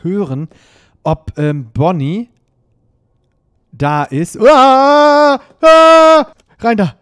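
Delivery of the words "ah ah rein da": high, loud startled screams; scared scream. Real, not acted. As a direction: shriek at the sudden scare. Scared by animatronic.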